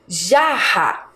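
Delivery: jarra drawn out with a strong breathy H-like RR; slow teacher's model of jarra, strong RR. Shown as a demonstration. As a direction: stretch jarra, push the double R as an H sound. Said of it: "a minha pronúncia é forte".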